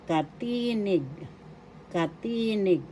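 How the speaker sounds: teacher carefully modelling "katinig"; pronunciation demo. Shown as a demonstration. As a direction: stress the middle of "katinig". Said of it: "You pronounce it as katinig".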